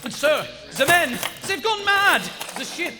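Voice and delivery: high, loud desperate alarm; panicked report. Acted. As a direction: blurt it out in dread. Lieutenant reporting ship is sunk.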